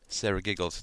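plain reading voice

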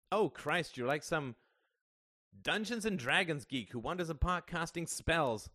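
high, exasperated outburst; crying out. A narrator's reading. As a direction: lash out in frustration. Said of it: "cried Ian".

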